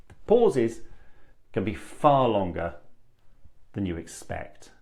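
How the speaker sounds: unhurried demo of long pauses. Shown as a demonstration. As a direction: leave long gaps between phrases. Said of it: "most people rush the pauses".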